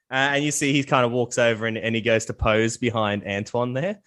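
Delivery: ordinary talk, nothing marked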